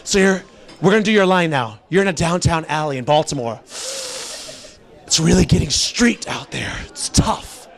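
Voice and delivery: low, hushed casting director, deadly serious; mock-grave scene setting; all serious. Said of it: "he's, like, all serious".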